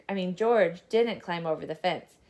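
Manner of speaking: usual speaking voice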